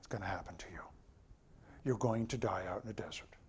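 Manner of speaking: soft hushed doom; God whispering the sentence to the grumblers; ominous whisper. Acted. God pronounces the desert sentence.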